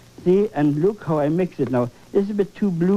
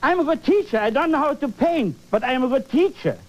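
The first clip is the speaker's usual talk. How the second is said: mocking bad teacher; 6 semitones higher, faster than usual. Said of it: "they would even say".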